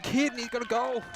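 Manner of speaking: high, loud burst of excitement; excited goal call. Real, not acted.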